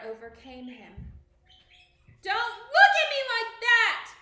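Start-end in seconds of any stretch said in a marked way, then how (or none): 2.2-4.1 high, loud scream of terror; terrified scream. A narrator's reading. King screaming in terror at the ghost. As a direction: scream at the ghost to stop staring. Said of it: "he screamed at the ghost".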